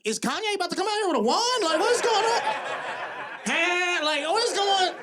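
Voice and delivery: very high-pitched incredulous questioning; mock bewilderment. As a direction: squeal it in comic disbelief. Acts bewildered reaction to film scene.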